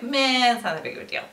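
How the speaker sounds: mock-unbothered brush-off; dismissive meh. Mimicking people unfazed by the shutdown, dismissive. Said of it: "So the first one, they were like".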